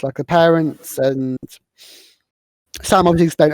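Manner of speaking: ordinary delivery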